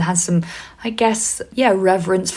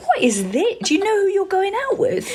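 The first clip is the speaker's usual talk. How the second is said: high, laughing incredulous question; giggling, incredulous mockery; laughing disbelief; 14 semitones higher than usual. Mocking boyfriend's prudishness.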